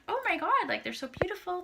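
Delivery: high, delighted gush. Retold or imitated. Thanking the date for the roses, high and gushing. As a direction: swoon over the bouquet. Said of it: "So I'm like".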